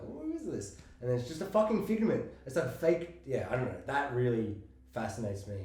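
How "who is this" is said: high, soft intrigued question; intrigued viewer. Retold or imitated. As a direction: ask it like you're suddenly hooked. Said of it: "I'm like".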